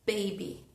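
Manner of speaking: put-on "Baby" con las dos B fuertes; "Baby" soltando el aire con fuerza en cada B; strong plosive b. Shown as a demonstration. ("Baby" con B explosivas)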